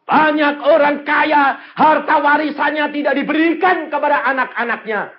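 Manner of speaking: loud, high-pitched preacher's cry; full-force preaching. Real, not acted. Preacher declaring a prayer to God, full-force preaching. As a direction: proclaim it at full volume.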